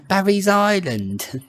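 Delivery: high, drawn-out mock Welsh; imitated Gavin and Stacey Welsh. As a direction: echo it in a slow Welsh sing-song. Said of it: "Have you seen Gavin and Stacey?".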